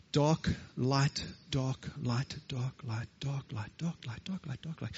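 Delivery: soft, low, plodding chant; tortoise counting. Acted. Tortoise counting leopard's spots one by one, plodding and soft. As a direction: count lightly in an unhurried tortoise rhythm, fading away. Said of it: "it wasn't very quickly 'cause it's a tortoise".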